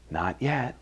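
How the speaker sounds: soft, unruffled answer; calm refusal. A narrator's reading. Master to the dizzy clay, calm quiet refusal. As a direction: turn down the plea quietly.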